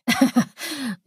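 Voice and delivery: short, high chuckle; villain's chuckle. Acted. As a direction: chuckle briefly with menace. Villain character's laugh.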